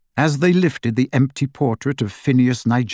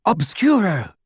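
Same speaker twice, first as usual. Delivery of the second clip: cried out; 6 semitones higher than usual. Acted. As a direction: spell cried high. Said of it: "Hermione cried".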